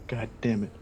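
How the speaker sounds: usual speaking voice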